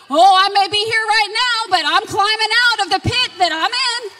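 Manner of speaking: loud, high, driving preacher voice; full-force preaching. Acted. Preaching to the congregation against Satan, at full force. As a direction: preach it hard, rising to a cry.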